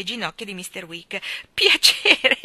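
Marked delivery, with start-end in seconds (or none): 1.5-2.4 laughing greeting. Acted. Wigg greets warmly, laughing.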